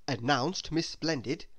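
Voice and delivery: plain reading voice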